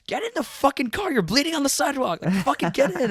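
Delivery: high, loud imitation of a yelling friend; urgent shouting. Friend ordering bleeding rider into car, urgent shouting. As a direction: yell exasperated orders from the car.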